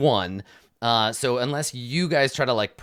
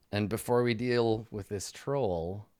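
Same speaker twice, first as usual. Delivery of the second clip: ordinary delivery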